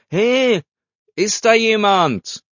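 loud, high shout into the distance; loud call. A narrator's reading. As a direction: call out loudly, drawing out the words. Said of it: "rief laut". (laut rufen)

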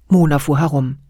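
plain reading voice

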